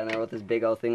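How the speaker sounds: usual speaking voice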